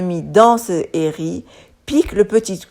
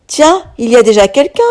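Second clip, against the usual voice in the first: surprised; 4 semitones higher, 4 dB louder, faster than usual. A narrator's reading. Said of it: "s'étonne-t-il".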